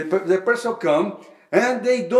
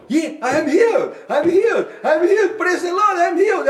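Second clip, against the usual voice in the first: much higher, louder cries with laughter; joyful healed cry; 11 semitones higher, 4 dB louder than usual. Retold or imitated. Healed woman crying out her joy to the preacher, elated.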